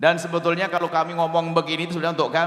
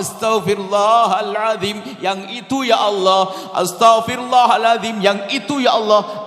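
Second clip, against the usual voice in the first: earnest repentant prayer; 4 semitones higher, 5 dB louder than usual. Shown as a demonstration. Preacher shows the congregation how to repent, pleading. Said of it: "Pastikan Anda sesaat saja Anda menghadap kepada Allah".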